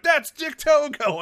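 high, slow announcer yell; excited shout. Retold or imitated. Commentator shouting the reveal of a surprise wrestler. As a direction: belt out the name like a commentator. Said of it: "Rocky Romero's like".

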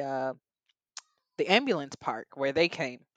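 ordinary delivery

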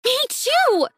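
high excited shout; excited scream. A narrator's reading. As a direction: shriek it with joy. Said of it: "I screamed".

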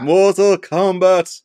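slow, high, loud arcade call; hammed-up tournament announcer, words stretched out; fighting game announcer. Mimics the Mortal Kombat game call.

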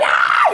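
a sudden piercing high shout; high yell. Retold or imitated. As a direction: let out a shrill burst of a yell. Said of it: "Gritó". (grito muy agudo)

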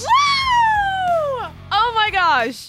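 loud, thrilled and gushing; excited. Real, not acted. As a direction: burst out with real excitement. Co-host reacts to big intro.

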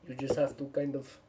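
badly faked Russian accent; halting attempt at a Russian accent. Shown as a demonstration. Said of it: "I can do a Russian accent".

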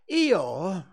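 a friendly, eager little bear; cheerful bear Pooh. Acted.

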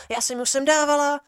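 high, nagging voice of a fussy lab assistant; mocking lab assistant. Lab assistant nitpicking the students' bench, high mocking voice.

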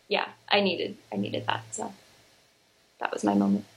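near tears, voice dropping to an almost silent breath; fighting tears to get the last words out; holding back tears. Real, not acted. Moved to tears while thanking her best friend, barely voiced. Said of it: "you're gonna make me cry".

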